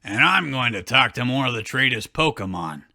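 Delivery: deep put-on creature voice with a sly edge; voicing Pikachu. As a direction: growl it low, like a scheming little beast.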